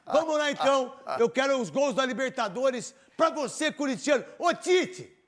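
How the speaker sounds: loud, high, pumped intro; hyped-up announcer. Real, not acted. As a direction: fire up the crowd. Host hyping goal highlights.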